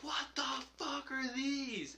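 high, soft, drawn-out disbelief; retold bewilderment. Recalling first sight of potato bugs to friends, bewildered. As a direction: imitate a slow, hushed, high-pitched shock.

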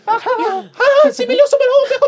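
squealing high, loud mock French child; French-speaking little boy. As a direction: play a giggly tot babbling in French. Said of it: "like, a four-year-old Tom ... just knows French".

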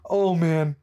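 ordinary delivery

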